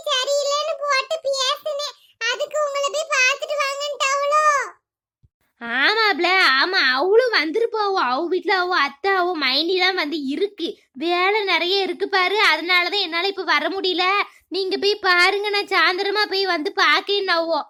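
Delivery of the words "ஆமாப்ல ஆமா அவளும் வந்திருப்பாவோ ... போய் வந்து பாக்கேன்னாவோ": much lower put-on voice of an older woman, explaining; தாழ்ந்த குரலில் வயதான கிராமத்துப் பெண்; older village woman. Another character voiced. (Neelambari சொன்னதை விளக்கும் வயதான பெண், மிகத் தாழ்ந்த குரலில்)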